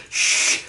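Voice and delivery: hushing someone with a shh; a drawn-out unvoiced shh; long shush. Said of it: "I said".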